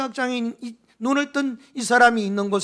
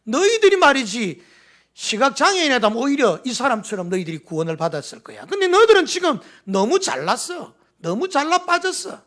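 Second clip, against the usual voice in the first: loud, higher scolding rebuke; 4 semitones higher, 3 dB louder than usual. Acted. Jesus scolding the proud Pharisees, a loud rebuke. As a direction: scold them for their pride.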